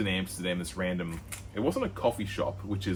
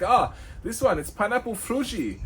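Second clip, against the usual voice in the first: much higher, hammed-up stoner salesman; shop guy; 13 semitones higher, 4 dB louder than usual. The shop guy offering jars to smell, in a high hammed-up voice. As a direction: sell the strain in a high silly voice.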